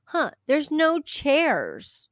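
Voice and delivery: high-pitched, drawn-out fake musing; mock obliviousness. Organizer surveying her failing event, mock-oblivious. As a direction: play someone clueless noticing a problem. Mocking an organizer shrugging off problems.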